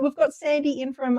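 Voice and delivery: normal talking voice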